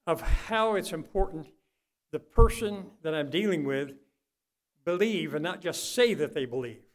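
normal talking voice

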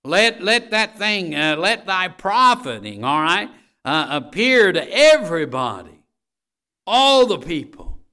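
loud, high, rising sermon exhortation; full-force preaching. Acted. Preacher urging the congregation to act, at full force. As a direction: drive the verse home to the congregation. Proclaims profiting appear to all.

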